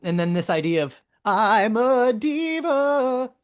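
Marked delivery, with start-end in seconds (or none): 1.2-3.3 high, slow drawn-out diva line; mock diva vibrato, stretched long. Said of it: "he almost says it with the vibrato".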